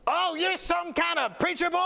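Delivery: high taunting demon voice breaking into cackle; mock them like a cackling demon; demon laugh. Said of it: "Like a demon laugh".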